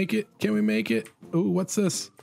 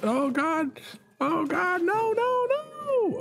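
climbing higher with each no; rising panic; slower than usual. Real, not acted. Player watching the car fall, shrieking higher and higher.

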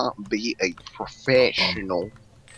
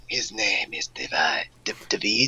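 whispering; 3 semitones lower, 4 dB quieter, slower than usual. Acted. Whispered briefing.

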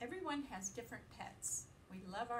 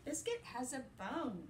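lively, sing-song picture-book reading; reading to children; 5 semitones higher than usual. A narrator's reading. Teacher reading a puppy book to her class, playful and lively. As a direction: read it brightly to little listeners.

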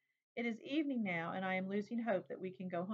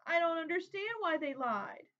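high, louder tearful question; quivering child voice; 9 semitones higher, 5 dB louder than usual. A narrator's reading. Hurt child asking her brother why they lied, high and quivering. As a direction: let the child's voice shake with hurt. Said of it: "My voice quivers as I say it".

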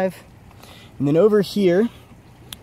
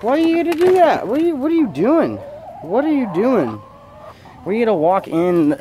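high-pitched, louder pet talk; 9 semitones higher, 3 dB louder than usual. Real, not acted. Surprised by his hens, in a high, scolding pet voice. Talking to the chickens.

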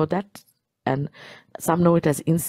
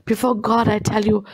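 ordinary talk

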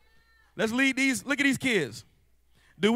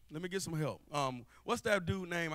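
ordinary talk

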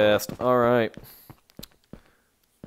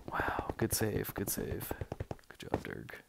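whispering; 2 semitones lower, 11 dB quieter, slower than usual. Real, not acted. Relieved praise after danger.

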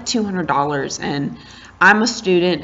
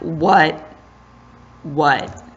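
ordinary delivery

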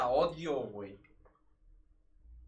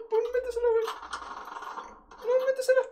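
frantic yell; 5 dB louder, faster than usual. Real, not acted. Urging kill in firefight.

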